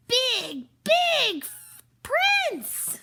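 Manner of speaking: put-on high loud childlike telling; little girl voice. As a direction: squeal it like an excited small kid. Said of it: "Lenore told her version".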